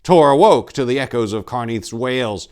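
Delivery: loud, high, urgent storytelling; tense narration. A narrator's reading. Narrator driving the attack scene, loud and urgent. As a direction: read it like the horror is breaking loose.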